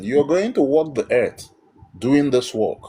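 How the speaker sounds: ordinary delivery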